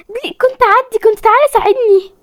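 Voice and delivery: high urgent call to teammate; anxious high plea. Real, not acted. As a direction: beg your partner to come help. Calling teammate for help.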